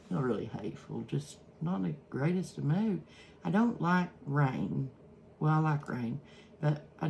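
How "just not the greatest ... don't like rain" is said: normal talking voice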